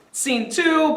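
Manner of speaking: ordinary talk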